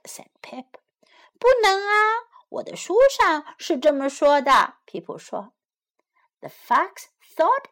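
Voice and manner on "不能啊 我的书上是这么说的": higher chick voice; little chick. A narrator's reading. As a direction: insist as a clever little chick. Pip insists in translation.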